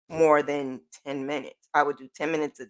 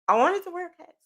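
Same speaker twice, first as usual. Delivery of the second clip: high whiny wish; 11 semitones higher, much faster than usual. Real, not acted.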